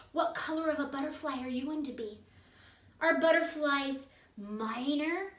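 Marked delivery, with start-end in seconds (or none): 0.0-2.2 soft, fast whisper. Acted. Teacher whispering to the kids about butterflies. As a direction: hush it like a secret.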